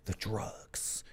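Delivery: slow, secretive whisper; mock whisper. Streamer confessing to chat that he drank coca tea, slow mock whisper.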